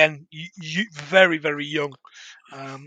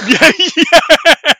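high laughing agreement; laughing yeah; 9 semitones higher, 7 dB louder than usual. Real, not acted. Laughing at his cohost's joke, high and loud. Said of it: "For legal reasons".

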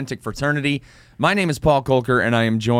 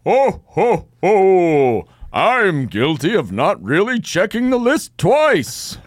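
loud hearty ho ho ho with slow booming guilty line; hammed-up Santa Claus, slow and jolly; big mock Santa voice; jolly Santa laugh; 6 semitones higher, 4 dB louder, much slower than usual. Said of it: "you've gotta be the Easter Bunny".

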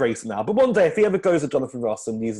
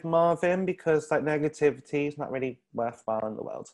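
mock earnest; 4 semitones higher than usual. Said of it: "Only joking".

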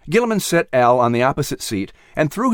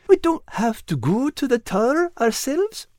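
relieved little creature; 12 semitones higher than usual. Acted. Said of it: "with a voice trembling in relief".

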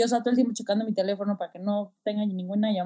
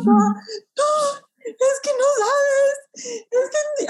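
much higher, very slow, crying voice; overwhelmed, words breaking; imitating herself close to sobbing; retold near-tears; 4 dB louder, much slower than usual. Retelling her overwhelmed reply.

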